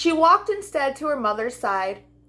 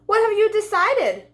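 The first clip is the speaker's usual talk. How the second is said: high, bright little lamb asking; a small lamb asking her friend; lamb's voice; 9 semitones higher than usual. A narrator's reading. Lamb asks the butterfly, higher voice. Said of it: "the lamb asked the butterfly".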